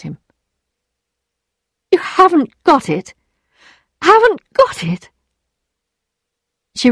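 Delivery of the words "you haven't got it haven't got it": high, stunned echo; disbelieving. A narrator's reading. Emma repeats Rodolphe's refusal, disbelieving. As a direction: throw it back at him, pitch climbing.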